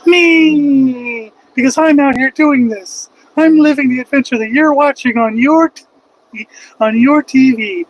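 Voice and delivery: proudly shouting his own answer; triumphant shout. Real, not acted. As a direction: yell it out like a winner.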